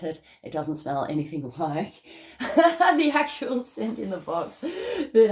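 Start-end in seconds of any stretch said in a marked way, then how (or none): 2.4-2.9 a sudden squeal of a laugh, high and loud; high burst of laughter. Real, not acted. Vlogger to viewers, bursting into a high laugh. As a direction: laugh out at your own joke. Laughs at the incense not matching.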